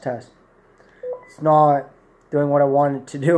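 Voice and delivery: ordinary talk, nothing marked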